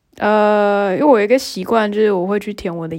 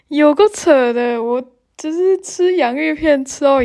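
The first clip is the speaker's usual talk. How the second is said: laughing disbelief; 6 semitones higher than usual. Real, not acted. Telling viewers chips broke her tooth, laughing. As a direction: chuckle at how absurd it is.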